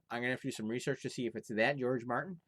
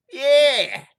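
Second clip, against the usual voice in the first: much higher, loud careless yell; carefree yell; 18 dB louder than usual. Acted. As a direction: shout it up with a shrug. Lad answers chimney.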